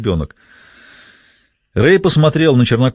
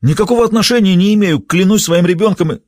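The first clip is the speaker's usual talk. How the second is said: high, louder frantic begging; desperate pleading; 9 semitones higher, 3 dB louder than usual. Acted. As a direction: swear on everything, panicking. Winky pleading, begging innocence.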